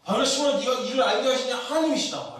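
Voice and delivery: high, loud burst of admiration; acted-out gushing praise, pitched right up; effusive praise. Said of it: "이러면서 막 칭찬을 이렇게 이빠이 받았어요".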